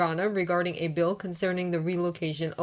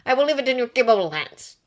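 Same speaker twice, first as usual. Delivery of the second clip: pompous senator voice; 6 semitones higher, 6 dB louder, faster than usual. A narrator's reading. Biwa's closing words.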